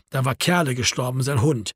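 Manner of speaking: usual speaking voice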